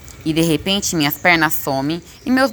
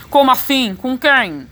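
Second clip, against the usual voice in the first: higher, louder, oddly startled voice; voz esquisita; 5 semitones higher, 4 dB louder, slower than usual. A narrator's reading. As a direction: blurt it in a strange, raised voice. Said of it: "a voz fica esquisita". (voz esquisita, mais aguda, de susto)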